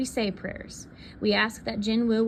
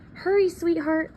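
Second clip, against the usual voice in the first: mom calling up, higher and a touch louder; mother hurrying her child, calling up the stairs; 9 semitones higher, 3 dB louder than usual. A narrator's reading. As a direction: call her down urgently. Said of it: "Mom calls".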